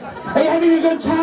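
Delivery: high-pitched call out to the crowd; rousing crowd greeting. Real, not acted. Comic greets the audience at the show, rousing high-pitched call.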